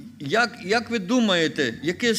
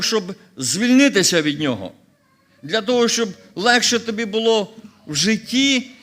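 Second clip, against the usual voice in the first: loud, insistent preaching; preaching at full force; 3 semitones higher, 5 dB louder than usual. Acted. Pastor insisting to the church that God said it all, loud. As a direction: drive it home from the pulpit.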